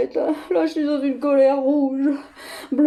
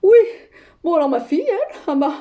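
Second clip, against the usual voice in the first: ordinary talk, nothing marked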